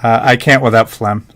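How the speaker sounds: ordinary talk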